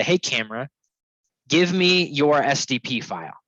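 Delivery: ordinary delivery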